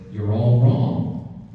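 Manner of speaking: slow and loud, each word landed; drawn-out verdict. Retold or imitated. Telling the yelling audience they are all wrong, slow and loud. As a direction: hold it out like a punchline. Punchline to shouting keynote crowd.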